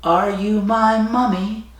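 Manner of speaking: put-on high, slow, drawn-out child's plea; creepy child. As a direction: imitate an eerie child, stretching each word high. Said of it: "almost that exact tone".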